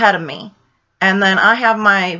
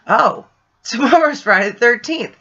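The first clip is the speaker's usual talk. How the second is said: repeating it with a surprised laugh, higher; laughing realization; 6 semitones higher than usual. Retold or imitated. To her friend Jill, laughing that tomorrow is Friday the thirteenth. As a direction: laugh as it sinks in. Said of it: "I said".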